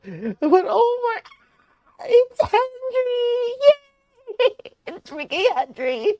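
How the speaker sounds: very high, loud squeal breaking into laughter; ecstatic squealing. Real, not acted. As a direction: squeal slowly and joyfully. Squealing over favourite character again.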